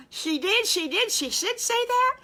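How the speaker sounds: very high, loud, delighted disbelief; high-pitched excited amazement; astonished piglet squeal. Acted. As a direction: squeal it like a thrilled little piglet.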